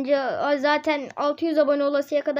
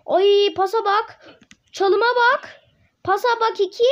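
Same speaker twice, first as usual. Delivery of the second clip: high, loud delighted cheer; excited cheer; 4 semitones higher, 5 dB louder, slower than usual. Real, not acted. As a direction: marvel at the pass. Admiring a play.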